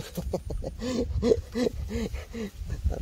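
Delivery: delighted high laugh burst; gleeful laugh. Real, not acted. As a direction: laugh out at the catch. Laughing at the big fish.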